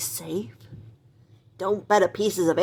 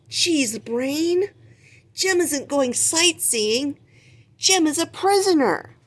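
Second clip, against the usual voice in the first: higher, slowed scolding; exasperated Gonzo voice; 8 semitones higher, 4 dB louder, slower than usual. Acted. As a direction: set the rat straight, exasperated.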